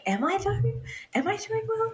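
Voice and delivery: high, questioning voice; doubtful inner thought. Retold or imitated. Labouring mother doubts herself, high questioning voice. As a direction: squeak out the self-doubt. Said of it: "I just remember thinking".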